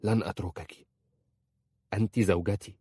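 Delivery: understated firm resolve; firm, settled declaration; decisive. A narrator's reading. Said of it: "قال في حسم".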